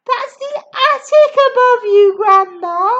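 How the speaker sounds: high, loud warning; boy calling up to Grandma. Acted. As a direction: shout a warning upward. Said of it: "George called out".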